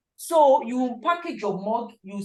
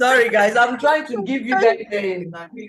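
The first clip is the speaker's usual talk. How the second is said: loud, raised voice; speaking up; 3 semitones higher, 9 dB louder, slower than usual. Real, not acted. Trainer apologising to listeners who couldn't hear, speaking up loudly. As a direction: project it out for everyone to hear. Speaks up after whispering complaint.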